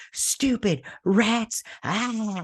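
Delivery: high, slow, hammed-up snarl; a bratty kid lashing out; mock angry kid. Son hitting the floor, mock angry snarl. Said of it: "And he's like".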